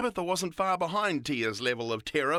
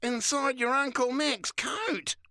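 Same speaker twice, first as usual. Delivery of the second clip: more disbelieving; 10 semitones higher, slower than usual. A narrator's reading. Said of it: "Tia said even more disbelievingly".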